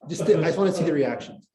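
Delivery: ordinary talk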